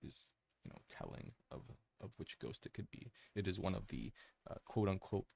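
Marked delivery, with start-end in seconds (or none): 0.1-3.1 whisper in left ear. Real, not acted. Drops to unvoiced aside.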